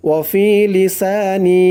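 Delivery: ওয়াফি লিসানি drawn out slowly with each long vowel held a full beat; slow Quran recitation. Shown as a demonstration. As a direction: chant ওয়াফি লিসানি slowly in tajweed style. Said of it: "এক আলিফ টান দিতে হবে". (ধীরে তাজবীদসহ তেলাওয়াত)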